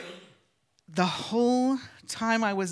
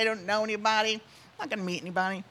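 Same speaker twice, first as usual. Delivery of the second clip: usual speaking voice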